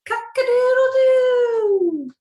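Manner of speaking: high, loud, slow crowing cock-a-doodle-doo; rooster crow. Acted. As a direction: crow it out like a proud rooster at dawn. Rooster crowing in farm story.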